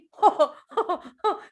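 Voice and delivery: loud high laugh; big belly laugh. Acted. Tickled elephant bursting into laughter.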